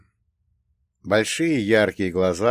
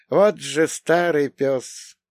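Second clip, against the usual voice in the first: grudging curse; 6 semitones higher, 3 dB louder, much slower than usual. A narrator's reading. Aunt curses the old schemer.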